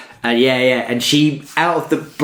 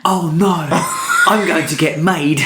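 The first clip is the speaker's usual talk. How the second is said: higher-pitched imitation of dread; mock dread; 5 semitones higher than usual. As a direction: act out someone about to be found out. Voices fake Welshwoman fearing exposure.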